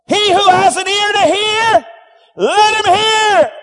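high, loud proclamation, slowing at the end; loud preacher's cry, stretched on let him hear. Shown as a demonstration. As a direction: cry it out to the crowd. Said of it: "He cried".

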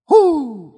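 high, excited "whoo"; real burst of excitement; fired-up whoop. Real, not acted. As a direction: let out a thrilled preacher's whoop. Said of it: "that fires me up".

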